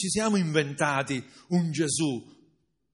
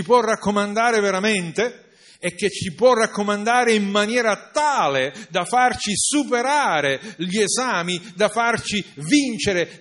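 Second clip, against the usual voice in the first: higher, louder questions to the church; fervent pulpit questions; rousing preaching; 6 semitones higher, 6 dB louder than usual. Acted. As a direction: challenge the listeners. Rousing rhetorical questions to congregation.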